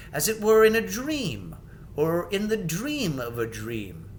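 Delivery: puzzled king half lost in memory; wondering King Edmund. Acted. King Edmund recalls dream.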